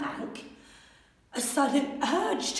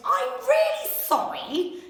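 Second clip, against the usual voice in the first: put-on higher, small apologetic child voice; little dinosaur voice; 10 semitones higher than usual. A narrator's reading. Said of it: "He said".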